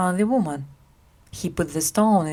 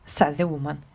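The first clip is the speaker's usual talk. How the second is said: usual speaking voice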